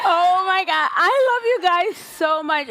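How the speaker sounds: high, loud, tearful laugh-cry; overwhelmed joy breaking into tears; teary and laughing. Real, not acted. Founder moved by the crowd's welcome, laughing through tears.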